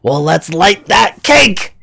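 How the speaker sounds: high, loud, drawn-out goblin yell; high-pitched goblin battle cry. Acted. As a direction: call it out slowly as a rowdy goblin. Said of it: "hear the goblins yell out and go".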